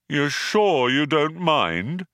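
needy, drawn-out gloom; probing. A narrator's reading. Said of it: "probed Marvin".

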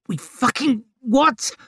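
high, slow incredulous snarl. A narrator's reading. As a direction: snarl it back in disbelief. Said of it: "Vic snarled back at him".

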